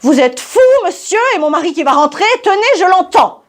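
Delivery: high-pitched, rapid, panicked fury; furious woman. Acted. Blonde wife to her suitor as husband returns, furious. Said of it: "d'un air furieux".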